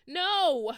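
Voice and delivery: piercing high scream. A narrator's reading. Snaketail screaming as he is stabbed. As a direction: scream as the knife goes in. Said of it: "He screamed".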